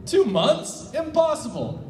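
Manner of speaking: high, incredulous cry; can't believe the two months; disbelieving. Acted. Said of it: "Dookie was disbelieving".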